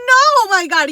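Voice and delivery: loud, high, exasperated no; retold refusal. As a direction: shoot the friend down loudly. Retelling her refusal to excited friend.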